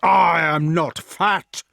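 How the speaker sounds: drawn-out indignant shout. A narrator's reading. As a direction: shout back, stretching the words. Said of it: "shouted Galapas".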